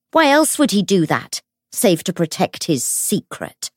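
high, loud, drawn-out tirade; furious accusation. A narrator's reading. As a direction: denounce him slowly, weighting each phrase. Enraged woman accusing before a crowd.

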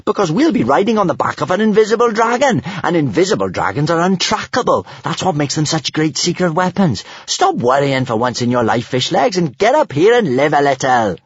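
put-on high, eager, cocky girl's voice; grinning. A narrator's reading. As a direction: talk them round with a grin. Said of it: "grinned Kamikaze".

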